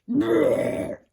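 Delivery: loud rumbling belch noise. A narrator's reading. Kronos belches out the stone, a loud belching noise. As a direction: let out a big belch.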